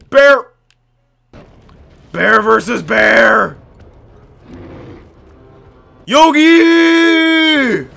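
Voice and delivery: loud, high battle cry; rally your bear into the fight; excited shout. Real, not acted. Gamer summoning his bear to battle, excited shout.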